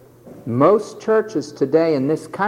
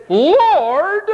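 high pleading Lord; 10 semitones higher, 5 dB louder than usual. Retold or imitated. Mimicking the man's address.

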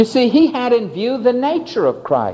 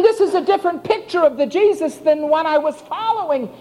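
fearful wonder; 10 semitones higher than usual. Acted. Disciple shaken that the wind obeyed, fearful wonder. Disciple's question voiced with fear.

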